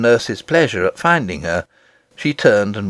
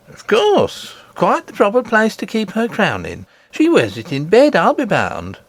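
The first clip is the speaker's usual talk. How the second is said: higher, teasing humouring voice; mock-agreeing nurse; 9 semitones higher than usual. Nurse humouring the princess, lightly sarcastic.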